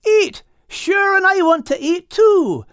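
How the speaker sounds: very high, excited little voice; a greedy little creature thrilled by food; Al's eager voice. Acted. Al perks up at food.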